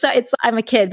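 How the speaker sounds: usual speaking voice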